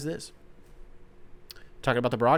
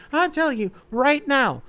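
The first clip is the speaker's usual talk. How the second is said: "I'm telling you right now" squeaked out much higher; mock lady voice; 12 semitones higher, 4 dB louder than usual. Shown as a demonstration. Host apes a lady to viewers, high squeaky mock voice. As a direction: pitch it way up like a fussy lady. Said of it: "not to sound like the lady".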